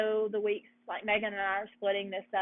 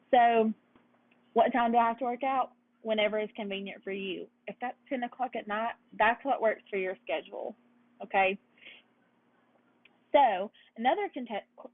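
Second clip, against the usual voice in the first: ordinary talk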